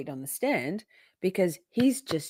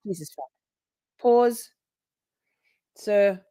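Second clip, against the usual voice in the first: ordinary talk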